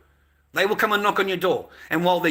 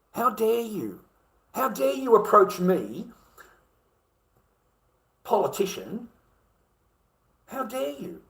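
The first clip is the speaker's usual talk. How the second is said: mock politician outrage; 5 semitones higher than usual.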